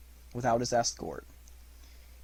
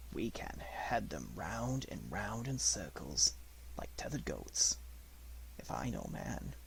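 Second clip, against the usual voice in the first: quiet; 2 semitones lower, 11 dB quieter than usual. Acted. Mowgli giving orders quietly.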